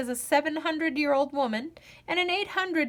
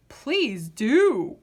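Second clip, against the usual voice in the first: growled; 4 dB louder than usual. Acted. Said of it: "growled the ghoul".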